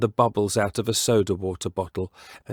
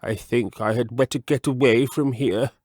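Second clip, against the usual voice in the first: lightly voiced frog deciding to leave; a hint of nervous frog; mild uneasy frog; wary frog voice; 3 semitones higher than usual. Mr Jeremy Fisher's voice.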